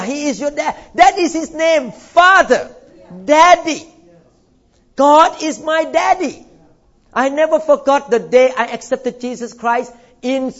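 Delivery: ordinary talk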